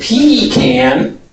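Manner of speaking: "pecan" slowly as PEE-kan; pronunciation demo. Shown as a demonstration. Teacher listing yet another "pecan". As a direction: draw out "pecan" with a long ee. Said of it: "And then there's".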